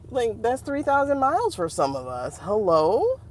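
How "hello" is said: usual speaking voice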